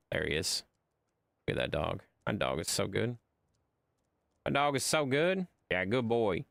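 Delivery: ordinary talk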